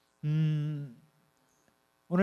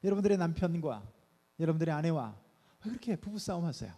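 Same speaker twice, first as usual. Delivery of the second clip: normal talking voice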